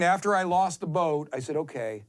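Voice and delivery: ordinary delivery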